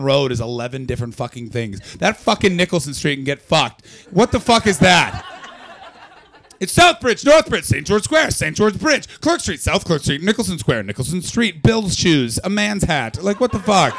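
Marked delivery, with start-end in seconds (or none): 2.0-13.9 loud, high comic tirade; exasperated rant. Acted. Comic ranting at locals about street names, exasperated. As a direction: reel off the names in mounting mock outrage.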